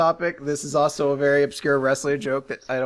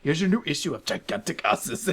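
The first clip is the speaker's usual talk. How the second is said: Homer; 2 semitones lower, 4 dB quieter than usual. Acted. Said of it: "Homer's wor-, like, pretending to be Krusty".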